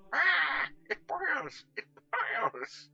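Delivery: high, loud cry of alarm; alarmed shout. Acted. Catweasel shrieking at the fizzing lemonade, high and loud. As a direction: yell as if the bottle is alive.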